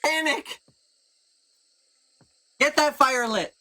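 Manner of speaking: high, urgent calling out; high-pitched camp alarm; panicked orders. Acted. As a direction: call out orders in mock panic.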